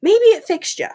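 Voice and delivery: light up with the idea; excited realization. Retold or imitated. Mate guessing the parasite fixed her, high and eager. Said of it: "And he's like".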